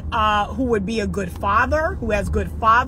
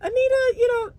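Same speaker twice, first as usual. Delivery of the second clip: high, coaxing suitor; a high, doting suitor; sweet-talking man; 10 semitones higher, faster than usual. Pretend. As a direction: woo Anita tenderly. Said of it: "let a man come along and say".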